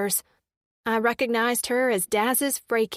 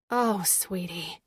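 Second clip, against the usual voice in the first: slow, low, soft comforting; hushed motherly compassion; tender sympathy; 4 semitones lower, 4 dB quieter, slower than usual. A narrator's reading. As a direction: soothe her gently, stretched out. Comforts crying woman.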